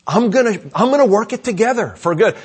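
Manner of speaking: high, loud, driving sermon voice; full-force preaching. Acted. Preaches as God speaking.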